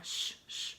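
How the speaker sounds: "sh, sh" as a long hushing hiss; sh sound demo. Shown as a demonstration. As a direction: push air through rounded lips for "sh". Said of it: "that S H sound".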